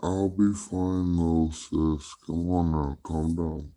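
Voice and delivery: usual speaking voice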